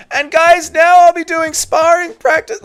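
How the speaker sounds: high-pitched whiny mock voice; whiny Ian Garry. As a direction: whine like a self-important fighter. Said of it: "in his whiny voice".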